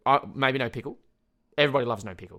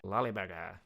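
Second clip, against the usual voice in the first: lower, softer repeat; understated silly echo; 3 semitones lower, 5 dB quieter than usual. Pretend. Comic echoing own joke name, understated silly repeat. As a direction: say it low and softly, without the long hold.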